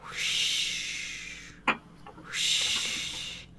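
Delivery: soft breathy whoosh of waves; quiet airy wave noise. Acted. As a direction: breathe out like crashing surf. GM imitates crashing waves.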